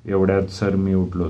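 ordinary delivery, nothing marked

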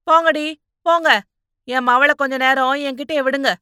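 loud, high-pitched ordering; shooing the crowd away. Acted. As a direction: send everyone off forcefully. She sends the crowd away.

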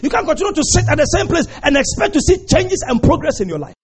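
fiery preacher build; preaching at full force. Acted. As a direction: preach it to the back row.

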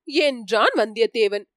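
plain reading voice

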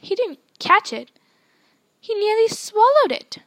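high, loud outraged howl; howling protest. Acted. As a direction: wail the complaint at the referee. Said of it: "Flint was still howling".

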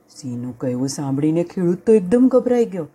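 usual speaking voice

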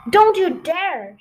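slow, loud Don't you dare, a sharp warning; stern mother warning. A narrator's reading. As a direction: stop the children cold, firm and loud. Mother fox forbids children.

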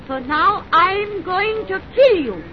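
slow, high, deliberate menace; murderous threat. Acted. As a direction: spell out the threat word by word. Announces killing.

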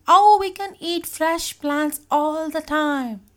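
high, slow, drawn-out whine; protesting. A narrator's reading. Tuna objecting to the plant diet, whiny protest. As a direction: wail the objection out.

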